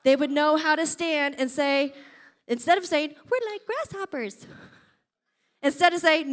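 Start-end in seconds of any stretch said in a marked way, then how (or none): 3.3-4.5 high, soft and small, cowering; mock-timid whine; timid grasshopper voice. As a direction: shrink into a scared little voice. Mocking fearful Israelites' words.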